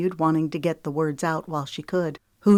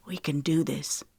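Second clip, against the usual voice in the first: reassuring; 4 dB quieter than usual. A narrator's reading. Reassuring frightened Hartley.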